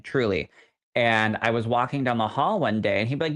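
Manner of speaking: normal talking voice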